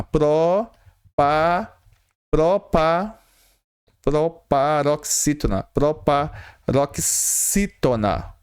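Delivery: "Proparoxítona" broken into beats, the last one slow; syllable by syllable. Shown as a demonstration. Teacher sounding out the term for students, piece by piece. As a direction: build "Proparoxítona" up in pieces, then stretch it out. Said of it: "eu teria uma proparoxítona".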